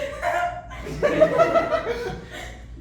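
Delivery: squealing high laugh; can't hold the laugh in; real high laughter. Real, not acted. Laughing before punchline.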